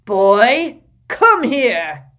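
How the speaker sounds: loud barked summons; barking order. Acted. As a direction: bark the command. Vernon summons Harry.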